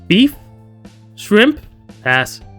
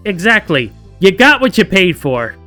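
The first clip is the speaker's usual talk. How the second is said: emphatic loud agreement; 6 semitones higher, 6 dB louder than usual. Acted. Voiced character agreeing emphatically.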